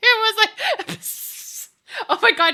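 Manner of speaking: high loud laughing fit; cracking up helplessly; real laughter. Real, not acted. Laughing hard while starting to tell the cat video.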